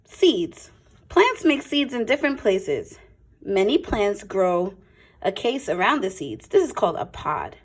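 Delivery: clear, lively picture-book read-aloud; a teacher's storytime voice, a touch brighter; reading to children. Acted. Reads picture book to class.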